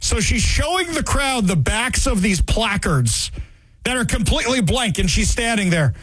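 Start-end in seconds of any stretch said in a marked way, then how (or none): none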